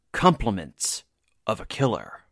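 ordinary talk, nothing marked

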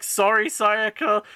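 high, slow sing-out apology; mock apology. Retold apology before throwing the gem.

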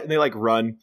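ordinary talk

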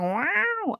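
mock cat hiss; high hissing cat noise; imitating an angry cat. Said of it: "it's hissing and going".

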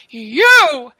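single pointed cry of accusation; accusing. Acted. Captive accuses the blind mouse.

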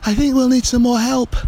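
put-on high, winded child's voice; out of puff, pitched high; huffing and puffing. A narrator's reading. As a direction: say it exhausted from pulling. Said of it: "as she huffed and puffed".

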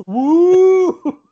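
long, high, loud mock ghost "woo"; drawn-out spooky hoot, hammed up; ghost wail. Playing the ghosts upstairs.

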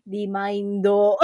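put-on Remindo slow with a slight Japanese accent. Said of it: "in, like, a Jap-, slightly Japanese accent".

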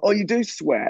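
high-pitched, mildly surprised question; retold surprise. Listener reacting to an impressionist's swearing, imitated surprise. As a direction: imitate someone caught off guard by a discovery.

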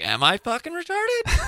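high-pitched questioning rise; mock question. Podcaster mocking a show title, high questioning tone. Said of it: "question mark".

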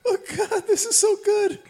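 very high, laughing delight; helpless laughter. Real, not acted. Co-host enjoys the bad film with friends, high laughing voice. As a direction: squeak it out through laughter.

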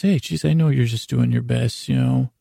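usual speaking voice

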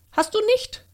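fine little voice. A narrator's reading. Fairy surprises the boy on the meadow, tiny high voice. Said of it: "ein ihm wohlbekanntes feines Stimmchen".

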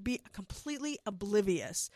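usual speaking voice

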